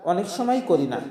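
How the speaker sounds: normal talking voice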